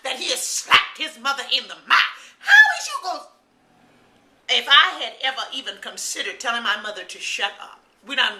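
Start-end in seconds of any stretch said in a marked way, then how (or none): 2.4-3.2 outraged outburst. Real, not acted.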